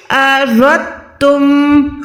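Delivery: अरदतुम slowly with दाल cut; correct pronunciation. Shown as a demonstration. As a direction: drop the दाल in अरदतुम, stretched slow. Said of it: "आज से क्या पढ़ना है?". (दाल के बिना अरदतुम)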